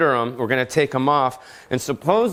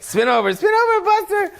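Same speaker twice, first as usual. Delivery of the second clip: high squealy "spin over"; high-pitched excited trick command; excited dog command. Real, not acted.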